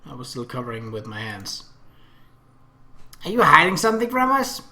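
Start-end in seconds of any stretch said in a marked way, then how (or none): 3.2-4.6 put-on high, loud, nosy girl voice; pry like an excited child; curious little sister. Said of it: "Akemi stared at my hands".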